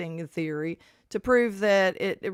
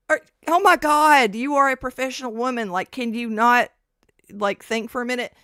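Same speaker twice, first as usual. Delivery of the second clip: exasperated rant; 5 semitones higher, 7 dB louder than usual. Retold or imitated. Exasperated retold rant at sister.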